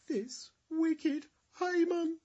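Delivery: high, loud and slow denunciation; accusing cry. Acted. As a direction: point and cry out his name. Esther accuses Haman before king.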